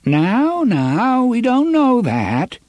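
put-on high quavery temporizing old man; quavering old man. A narrator's reading. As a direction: stall them in a shaky old voice. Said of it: "his quavering voice making him sound like a temporizing old man".

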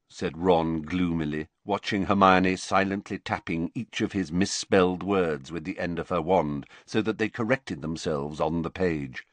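ordinary delivery

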